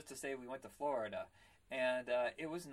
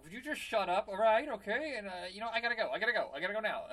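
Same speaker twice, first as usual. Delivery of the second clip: higher, louder pleading to shut up; flustered mugger; 7 semitones higher, 6 dB louder than usual. Retold or imitated. Mugger to screaming victim, flustered and hurried to leave. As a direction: act out a robber desperate to get away.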